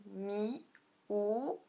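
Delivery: "mi", "ou" each sliding upward; rising tone. Shown as a demonstration. Teacher showing learners the second tone, rising "mi, ou". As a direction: lift "mi" and "ou" like questions. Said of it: "On monte".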